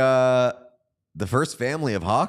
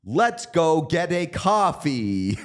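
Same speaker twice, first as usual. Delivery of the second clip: slow, higher "Let's go get a coffee" in a flat mock American accent; matter-of-fact mock American; 6 semitones higher, much slower than usual. Mocking how foreigners imitate Americans, matter-of-fact mock accent. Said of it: "very matter of fact".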